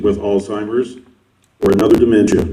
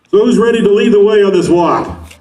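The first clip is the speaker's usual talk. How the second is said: high, upbeat hype announcer; rally hype; 6 semitones higher than usual. Real, not acted. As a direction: fire up the crowd to start. Hyping walkers at start line.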